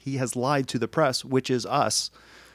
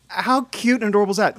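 higher, louder gushing over something cute; 9 semitones higher, 5 dB louder than usual. Retold or imitated. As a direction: gush about how adorable it is. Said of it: "I was like".

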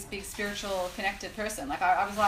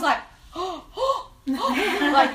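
louder gasp melting into a laugh; gasp, then laugh in disbelief; 8 semitones higher, 8 dB louder than usual. Retold or imitated. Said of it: "so freaked out".

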